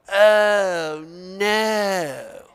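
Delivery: mock groan. Shown as a demonstration. Teacher showing the groan he does not want, mild mock groan. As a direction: show the groan to avoid. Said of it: "I don't want somebody to go".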